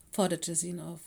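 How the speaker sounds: ordinary delivery, nothing marked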